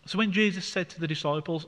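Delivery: ordinary talk, nothing marked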